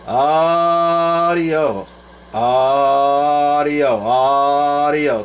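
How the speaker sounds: sing-song test call into a CB mic; drawn-out test call. Real, not acted. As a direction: stretch out each word into the mic. Keying mic for transmit test.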